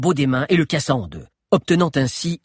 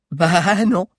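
higher, louder scornful spit; spat sneer; 7 semitones higher, 5 dB louder than usual. A narrator's reading. Guard mocking the visitors, scornful. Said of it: "cracha Diana avec un petit ricanement".

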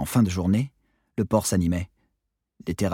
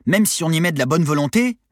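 high, loud cheeky comeback; cheeky shorty; 9 semitones higher, 7 dB louder than usual. A narrator's reading. Short sailor answering monitor, arguing. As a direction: argue back in a raised voice. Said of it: "répondit le nabo".

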